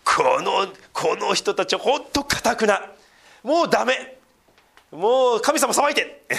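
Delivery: high, slow, fed-up mock plea. Pastor mocking Christians who want God to judge Japan. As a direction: ham up a self-righteous believer giving up. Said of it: "もう一つは".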